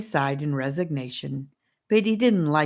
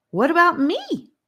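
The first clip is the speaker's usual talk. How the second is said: eager question; 13 semitones higher, 6 dB louder than usual. A narrator's reading.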